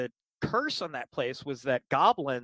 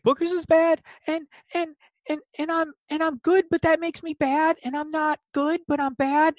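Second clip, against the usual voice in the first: high, stammering goblin voice; distressed little goblin tangled in his words; Boogers. Acted. Goblin explaining himself to the party, upset goblin voice. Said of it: "he's like, he's like".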